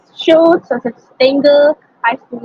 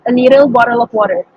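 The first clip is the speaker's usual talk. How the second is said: American accent; faster than usual. Shown as a demonstration. Student shows how Americans say it, American accent. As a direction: say "little bottle of water" briskly, American-style. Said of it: "American English accent, they would say".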